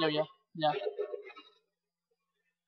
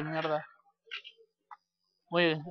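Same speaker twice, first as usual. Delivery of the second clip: normal talking voice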